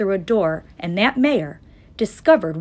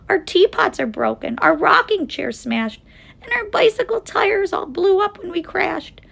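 high little voice from a speck; tiny mayor's voice; 10 semitones higher, 4 dB louder than usual. A narrator's reading. Mayor reports the crash damage to Horton, high distressed voice. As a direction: complain in a small, high-pitched voice. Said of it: "came the voice of the mayor".

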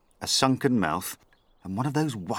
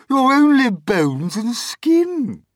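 high, loud, slow taunting aunt; Aunt Sponge crying out; 12 dB louder, slower than usual. Acted. Aunt Sponge taunting Aunt Spiker, slow, high and loud. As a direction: drag out the insult with relish. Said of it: "Aunt Sponge cried out".

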